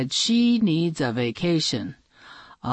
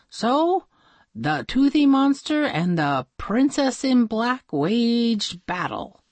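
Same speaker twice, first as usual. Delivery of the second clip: ordinary delivery, nothing marked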